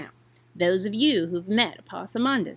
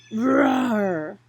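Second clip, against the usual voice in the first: single growled roar; a big cat roar; mock creature roar; 4 dB louder than usual. A narrator's reading. Creature roars.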